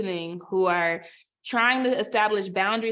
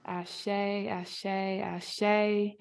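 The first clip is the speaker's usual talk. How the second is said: soft affirmation; 5 dB quieter, much slower than usual. Real, not acted. Affirming each speaker's words.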